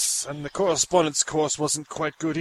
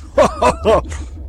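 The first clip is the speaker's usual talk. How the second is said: loud higher cackle; mad laugh; 9 semitones higher, 4 dB louder than usual. Acted. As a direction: cackle with glee.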